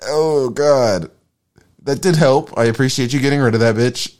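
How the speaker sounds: hammed-up thanks to the heavens; acted grateful Job. Job thanks God for wife leaving.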